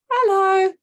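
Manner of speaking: loud, high sarcastic hello; high loud mock hello. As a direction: throw a snippy hello.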